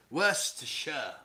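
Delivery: "Worcestershire" as "WUSS-tuh-shuh"; British pronunciation. Shown as a demonstration. Demonstrating county names, "Worcestershire" as "WUSS-tuh-shuh". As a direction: say "Worcestershire" squeezed short, no r. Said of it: "sounds more like Cambridgeshire".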